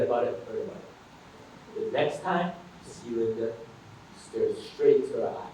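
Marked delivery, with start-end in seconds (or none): none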